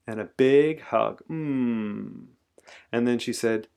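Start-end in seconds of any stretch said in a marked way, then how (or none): 1.3-2.3 long contented mm; drawn-out cosy hum; hug hum. A narrator's reading. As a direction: hum through a big squeeze. Acts out a big hug.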